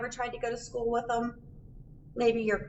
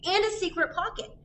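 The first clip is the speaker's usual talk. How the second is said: higher, eager mom voice; Mom showing off; 7 semitones higher than usual. Acted. Mom shows her kids her new backpack, higher and eager. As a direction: show off the new backpack like a proud kid. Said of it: "said Mom".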